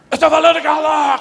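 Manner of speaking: husband screaming over his wife; furious shout. Retold or imitated. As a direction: shut her up at full volume. Husband explodes at interrupting wife.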